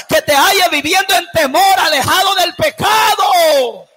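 loud, driving sermon delivery; full-force preaching. Real, not acted. Preacher warning the congregation that death will come, full-force preaching.

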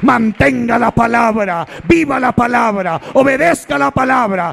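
rising, driving sermon voice, building to a shout; fiery preaching. Acted. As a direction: preach it hard and fast to the congregation. Predicador en pleno sermón. (predicación ardiente que va creciendo hasta gritar)

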